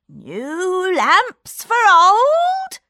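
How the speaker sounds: high, slow street-seller cry; drawn-out peddler call; old peddler calling out. A narrator's reading. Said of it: "he shouted".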